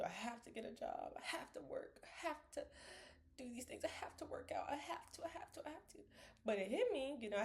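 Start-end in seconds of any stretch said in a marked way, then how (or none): 0.0-6.0 breathless high whisper, piling up the musts; pressured whisper. Retold or imitated. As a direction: whisper it like a trapped inner voice. Inner voice, anxious, listing obligations.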